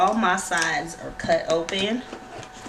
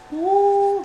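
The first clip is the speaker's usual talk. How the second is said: excited squeal; 13 semitones higher, 6 dB louder than usual. Real, not acted. Squeal at sticker.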